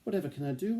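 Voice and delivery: high, mild wail; a touch of a worried wail; wailing mother. A narrator's reading. Mother wailing over her weak baby. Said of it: "wailed Missus Hargreaves".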